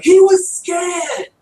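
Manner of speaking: put-on high, loud British-accented yell, drawn out; yelling Doctor. Podcaster imitating the Doctor defending the pig, mock British yell. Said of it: "The doctor yells at him".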